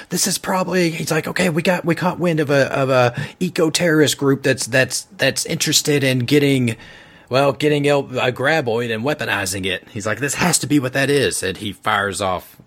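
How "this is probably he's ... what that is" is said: much higher, pressed agent explaining; much higher, urgent character voice; urgent Davis. Acted. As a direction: rush the briefing as Davis.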